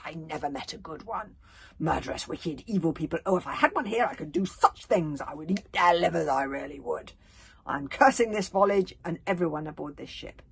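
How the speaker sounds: loud, bitter grandmother raging; furious cursing. A narrator's reading. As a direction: curse them all with relish. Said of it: "furious voice of Bear Cub's scary grandmother".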